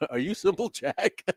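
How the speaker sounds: high laughing imitation; high mock question. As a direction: ask it high through laughter. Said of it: "And he goes".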